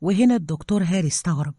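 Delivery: ordinary delivery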